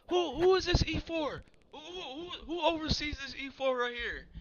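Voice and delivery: high-pitched, stammering outrage; mock going off. Sergeant major demanding the soldier's supervisor, high-pitched outrage. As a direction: imitate a flustered superior losing it, voice way up. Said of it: "just going off".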